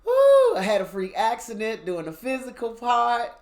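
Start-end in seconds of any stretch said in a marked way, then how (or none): 0.0-0.6 a loud high whoop; excited whoop. Real, not acted. Whooping to listeners about her test scores, loud and high. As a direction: let out a big high woo.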